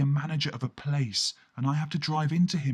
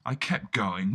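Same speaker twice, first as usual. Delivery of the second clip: usual speaking voice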